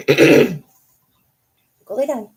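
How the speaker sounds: slow, stretched-out order; drawn-out command to dog. Real, not acted. Owner slowly ordering her dog to go lay down. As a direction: drag each word out to send the dog off.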